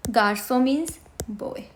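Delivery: ordinary talk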